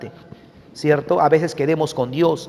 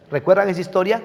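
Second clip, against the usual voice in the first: usual speaking voice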